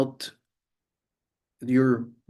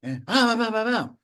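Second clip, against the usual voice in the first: higher cheeky reply, hammed up; other person, mock; 9 semitones higher than usual. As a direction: play the other talker pushing back. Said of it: "the other person go".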